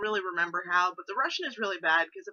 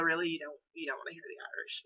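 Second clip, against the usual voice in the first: ordinary delivery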